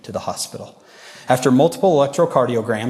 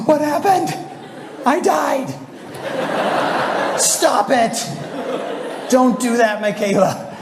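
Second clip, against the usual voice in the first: panicked friends; 14 semitones higher, slower than usual. Retold or imitated. Friends yelling at Michaela after her heart stops, high panicked voices. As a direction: play the friends freaking out, up high.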